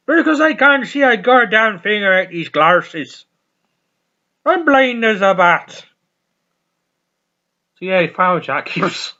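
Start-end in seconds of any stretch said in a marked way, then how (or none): 0.1-5.8 mock rant. Real, not acted. Ranting about losing glasses.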